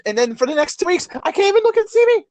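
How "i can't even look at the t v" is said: fast, higher, louder complaint; hammed-up fast griping; mock exasperated rant. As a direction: rattle it off in comic outrage. Comic rant continues.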